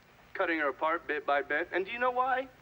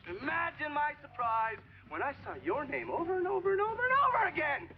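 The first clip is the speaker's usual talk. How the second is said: high-pitched accusation climbing into a yell; mounting outrage; 9 semitones higher than usual. Acted. Accuser confronting a senator with files, rising outrage. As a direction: build the repeated words to an angry peak.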